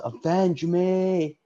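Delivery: high, slow, drawn-out mock yell; hammed-up, stretched-out plea; imitated movie yell. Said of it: "And then he yells".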